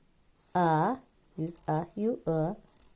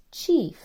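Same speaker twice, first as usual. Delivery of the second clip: "chief" said higher and louder; stressed test word; 8 semitones higher, 5 dB louder than usual. Shown as a demonstration. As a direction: announce "chief" with a punch. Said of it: "The next word is".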